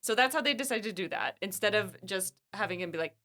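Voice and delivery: ordinary talk, nothing marked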